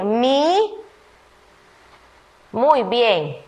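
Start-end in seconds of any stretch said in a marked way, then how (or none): 0.0-0.7 slow words read one at a time. Shown as a demonstration. Teacher rebuilding the sentence word by word for pupils. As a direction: read each word out slowly and separately. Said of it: "¿qué vamos a decir?".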